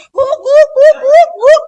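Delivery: loud high yapping barks; hammed-up happy dog yelps; dog barking. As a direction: bark like an eager little dog. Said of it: "bersikap seperti dogi".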